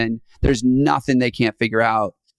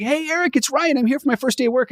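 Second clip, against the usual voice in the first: much higher, bright eager voice of a new hire on day one; 12 semitones higher than usual. Retold or imitated. New hire greeting the boss on day one, high and eager. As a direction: bounce in like it's your first day. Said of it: "The new person shows up and it's like".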